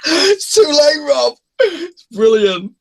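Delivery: high, slow words squeezed through laughter; can barely speak for laughing; squealing laugh. Real, not acted. Delighted with his song for Rob, laughing high.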